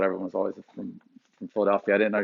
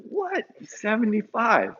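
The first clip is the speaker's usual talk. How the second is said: high, slow shocked "what?"; stunned disbelief; 11 semitones higher, slower than usual. Retold or imitated. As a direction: jump up in pitch, floored by it. Shocked reaction retold.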